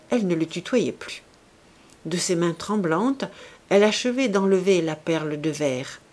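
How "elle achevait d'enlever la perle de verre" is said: ordinary delivery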